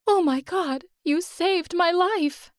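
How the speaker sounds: high, slow, breathless thanks; overjoyed gasp. Acted. Choking woman saved, overjoyed.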